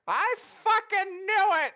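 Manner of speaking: triumphant yell. Acted. Puss bursts out on overhearing.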